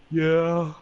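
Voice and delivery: hammed-up weepy voice; tearful. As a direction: imitate a friend answering through tears. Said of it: "he just breaks down crying".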